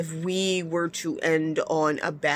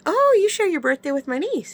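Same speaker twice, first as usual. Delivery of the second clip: high, louder retold excitement; delighted discovery; 12 semitones higher, 5 dB louder than usual. Woman finds coworker shares niece's birthday, high and excited. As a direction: exclaim it high, acting out the surprise.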